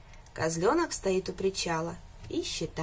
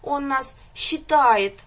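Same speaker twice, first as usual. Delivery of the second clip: slow, drawn-out, louder plaintive whine; plaintive drawl; 5 semitones higher, 7 dB louder, slower than usual. A narrator's reading. Calf complains to the others, slow and pitiful. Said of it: "жалобно протянул телёнок".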